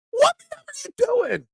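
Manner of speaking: high-pitched, strained yell of disbelief; exasperated mock shout. Pizza seller at a customer tucking the box under his arm, exasperated high shout. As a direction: cry it out way up high, as if at your wit's end. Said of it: "makes me wanna shout".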